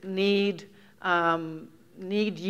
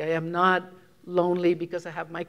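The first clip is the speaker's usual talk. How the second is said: ordinary delivery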